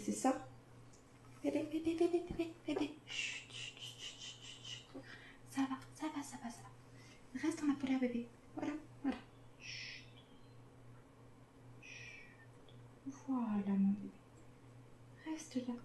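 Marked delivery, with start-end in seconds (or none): 1.4-2.9 high soft cooing; whispering over a crib. Real, not acted. 3.1-12.2 whispered shushing, soothing; whispering over a crib. Real, not acted. Shushing baby squirrel. 13.3-15.7 whispering over a crib. Real, not acted. Soothing baby quietly.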